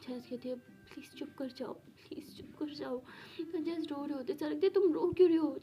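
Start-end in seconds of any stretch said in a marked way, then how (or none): 0.6-3.0 slow, trembling whispered plea; hushed desperate whisper; frightened whisper. Acted. Frightened girl begging in story. 4.7-5.6 higher, louder sharp question; insistent loud question; loud demand. Acted. Girl demanding why she cries.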